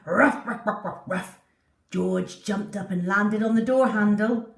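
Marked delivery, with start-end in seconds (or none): 0.1-1.4 playful dog barks. Acted.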